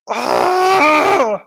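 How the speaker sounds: a sudden, loud, high oh; loud surprised oh. Real, not acted. Shouting in surprise when the post turns up. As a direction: shout it out as it jumps at you. Sudden find while scrolling.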